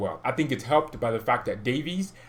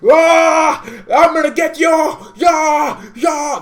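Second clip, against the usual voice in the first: put-on much higher, much louder shouted Polish accent as Lewandowski; Lewandowski accent impression; 13 dB louder, much slower than usual. Fan imitating Lewandowski chasing the ball, shouted Polish accent. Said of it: "That's my accent that Lewandowski has".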